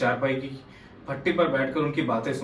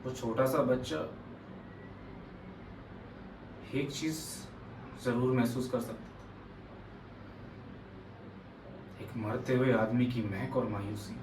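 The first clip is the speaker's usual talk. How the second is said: subdued and heavy, much softer; hushed, sorrowful; 11 dB quieter than usual. Acted. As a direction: let the voice sink quietly into painful memory. Monologue recalling a dying father's pain.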